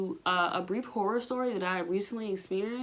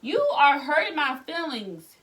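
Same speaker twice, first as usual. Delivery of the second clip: louder and higher, indignant comeback; loud mock outrage at the doctor; outraged retort; 6 semitones higher, 9 dB louder than usual. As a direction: fire back at the doctor in disbelief. Retelling pain at cervical check.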